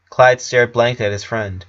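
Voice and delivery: plain reading voice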